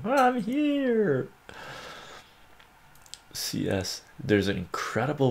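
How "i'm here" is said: high squeaky cat voice announcing itself; cat announcing himself. Retold or imitated. Cat voicing its entrance to the room, high and squeaky. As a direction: play the cat proudly arriving. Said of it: "Via announces when he walks into the room".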